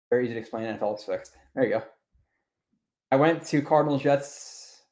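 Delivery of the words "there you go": ordinary talk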